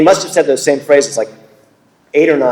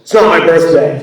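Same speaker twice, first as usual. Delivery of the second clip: louder, higher mock-crabby comeback; comic grumpy star at the mic; 5 semitones higher, 6 dB louder than usual. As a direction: imitate an old crab correcting a fan from the stage. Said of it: "such an old crab when he talks".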